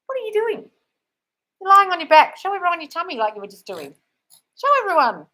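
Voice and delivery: high-pitched pet voice; playful dog talk. Real, not acted. Owner coaxing dog to show her tummy, playful high voice. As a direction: coo loudly, as to a dog.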